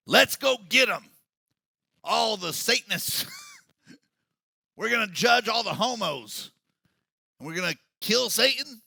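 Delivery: hammed-up hellfire rallying shout. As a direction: ham up an angry rally preacher. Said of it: "it sounds like redneck church".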